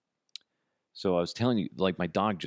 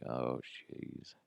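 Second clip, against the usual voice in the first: softer, lower sigh of dread; quiet dread; 6 semitones lower, 11 dB quieter than usual. Retold or imitated. Father recalling another night, quiet dread. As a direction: breathe it out with a sinking feeling. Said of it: "and I'm like".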